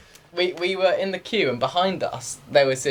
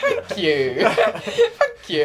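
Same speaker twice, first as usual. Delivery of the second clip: thanks squeaked out through real laughter; laughing thanks; 4 semitones higher, much slower than usual. Real, not acted. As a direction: try to say thanks but crack up. Thanking the odd stranger while laughing.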